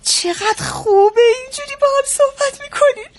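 high-pitched gushing approval; pleased daughter praising her parents; delighted. Acted. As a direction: coo with delight at the reconciled parents. Delighted that parents speak kindly.

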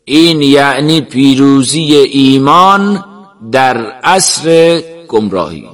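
slow, declaiming preacher's voice; fervent sheikh addressing a gathering. Acted. Sheikh speaking of young people filling the mosques, slow oratory. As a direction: proclaim it slowly, like a sermon.

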